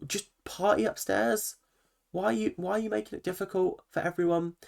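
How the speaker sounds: pitched well up, mock-exasperated telling-off; exasperated retort. Viewer retelling her own rant at a sulking housemate, high and exasperated. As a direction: ask it like you can't believe how petty he's being.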